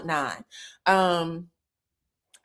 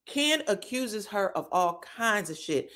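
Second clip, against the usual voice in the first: ordinary delivery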